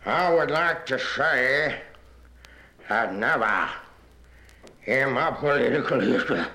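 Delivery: slow, halting political oratory with long pauses; pompous senator. Acted. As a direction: pause grandly between phrases like a senator.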